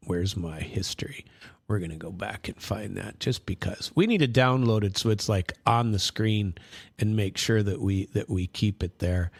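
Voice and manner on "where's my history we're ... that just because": soft unvoiced whisper to himself. Real, not acted. Hunting through his browser history, whispering to himself. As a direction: whisper it while searching for the clip.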